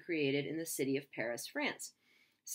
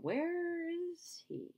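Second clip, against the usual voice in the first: high, slow puzzled voice; drawn-out high puzzled question; high, slow confused crowd; 13 semitones higher, much slower than usual. Retold or imitated. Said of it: "the people are going".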